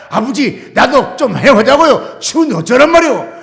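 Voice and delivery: loud, whiny plea to Father; loud pleading. Retold or imitated. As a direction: beg loudly against the cold. His own pleading reply to God. (추운데 어쩌냐고 크게 하소연)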